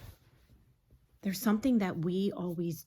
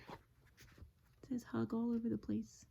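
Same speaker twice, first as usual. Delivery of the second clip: plain reading voice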